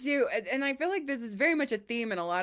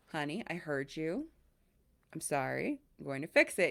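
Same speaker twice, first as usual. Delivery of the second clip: calm reassurance; 4 semitones lower, 6 dB quieter than usual. Retold or imitated. Calming husband with set phrase.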